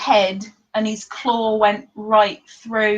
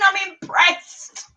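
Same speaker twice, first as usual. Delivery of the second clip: mock impressed; 8 semitones higher, slower than usual. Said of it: "Oh, I'm impressed now".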